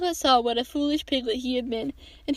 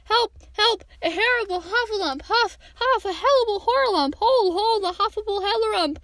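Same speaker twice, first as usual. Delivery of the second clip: high, frantic squeals tumbling into muddle; frightened little creature in full flight; panicked crying out; 8 semitones higher than usual. A narrator's reading. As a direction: cry out in flustered terror, words getting jumbled. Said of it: "still crying out".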